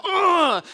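put-on kid's fed-up groan. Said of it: "And I'd go".

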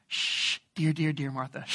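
soft shushing, dear said gently; soothing hush. Shown as a demonstration. Jesus calming anxious Martha, soft soothing hush. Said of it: "like, actually".

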